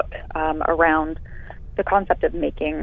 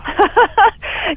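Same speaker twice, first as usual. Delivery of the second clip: high laugh; 7 dB louder than usual. Real, not acted. Laughs after thanks.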